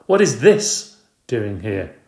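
slow, loud, affronted question; a tired mother finding a rat in her house; irritated demand. Acted. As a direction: glare at it and demand. Woman finds hamster, irritated.

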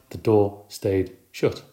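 ordinary delivery

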